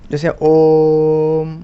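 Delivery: ओम stretched like a temple chant; prolonged vowel. Shown as a demonstration. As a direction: chant ओम, holding the vowel far past a long one. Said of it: "उसको हम लोग प्लुत स्वर बोलते हैं".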